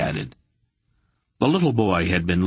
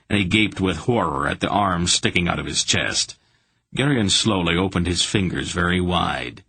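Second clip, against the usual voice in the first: ordinary delivery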